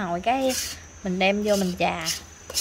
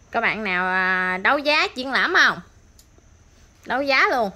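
mock auctioneering; 6 dB louder than usual. Vlogger offering viewers the mould for auction, mock-boastful. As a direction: call out for bids as a joke.

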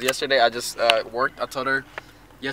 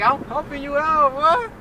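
exasperated; 13 semitones higher, slower than usual. Retold or imitated. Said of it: "I'm like".

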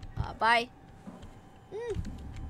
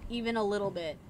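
ordinary talk

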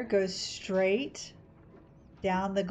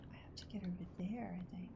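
whispering; 16 dB quieter than usual. Real, not acted. Reassuring friend while concentrating.

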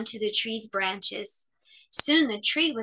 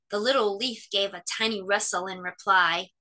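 ordinary delivery

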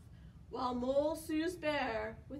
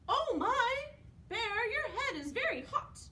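high-pitched worried mouse; fretting little mouse; 8 semitones higher, faster than usual. A narrator's reading. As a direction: fuss over a sick friend as a mouse. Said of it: "Mouse mutters".

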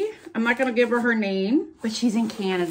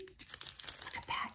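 whisper; 21 dB quieter, much slower than usual. Real, not acted. Showing stickers.

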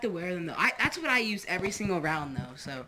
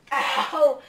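pained yell; 5 semitones higher, 7 dB louder than usual. Real, not acted. Landed on crossbow.

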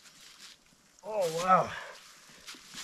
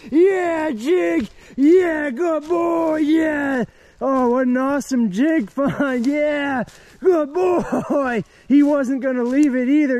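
high, loud shouted praise for the dog; excited praise; 7 semitones higher, 3 dB louder, much slower than usual. Real, not acted. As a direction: cheer the dog on, truly thrilled. Dog finds a bone, owner cheers.

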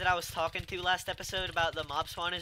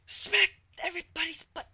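excited yell; 8 dB louder than usual. Real, not acted. Excited yell during chaotic fight.

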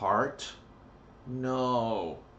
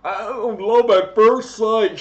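high, loud, slow whiny mimicry; mock whiny voice; 10 semitones higher, 11 dB louder, slower than usual. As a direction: whine it like a sappy fool. Mocking believers of love.

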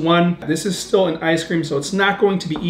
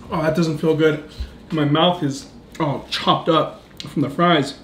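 ordinary delivery

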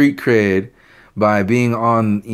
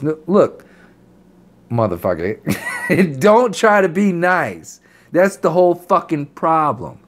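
angry rant; 7 semitones higher than usual. Real, not acted.

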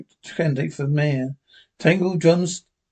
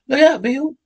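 Jingles' warning; 10 semitones higher than usual. Acted. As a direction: shout a warning to Bill.

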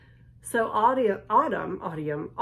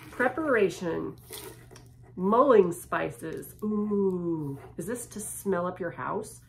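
ordinary delivery

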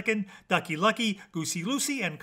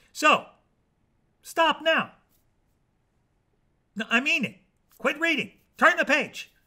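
higher-pitched, emphatic commands; short, insistent bursts with pauses between; insistent orders; 9 semitones higher, 4 dB louder than usual. Acted. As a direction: order the reader to stop, like you mean it. Narrator orders reader to stop.

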